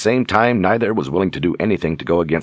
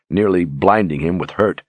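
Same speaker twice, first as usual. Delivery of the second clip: ordinary delivery, nothing marked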